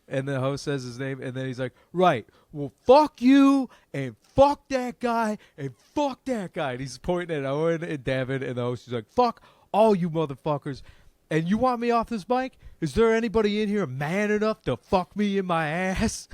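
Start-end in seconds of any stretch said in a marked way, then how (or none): 1.9-6.7 loud, high mock drunk yelling; drunk rant. Drunk comic cursing everyone out. 9.1-16.3 rowdy mock drunk taunt, higher; drunk challenge. Drunk comic challenging the room.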